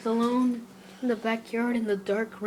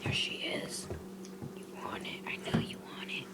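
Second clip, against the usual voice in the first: very soft unvoiced whisper to a dog; teasing whisper; 16 dB quieter than usual. Real, not acted.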